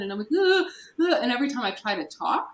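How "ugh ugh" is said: high, loud panicked gasps imitated; gasping for breath. Speaker re-enacts their panic attack for a listener, gasping. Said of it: "hearing me gasping for breath".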